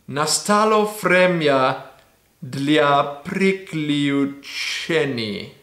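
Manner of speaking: very slowly. Shown as a demonstration. Reading Russian for viewers, said very slowly. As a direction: drag the Russian words out very slowly. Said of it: "I had to say it very slowly".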